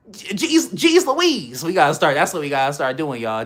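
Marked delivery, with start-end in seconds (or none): 0.1-1.6 much higher jeez Louise; hammed-up wholesome exclamation; goody-goody voice. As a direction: squeak it out like a prim kid. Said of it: "that's how we gotta talk now".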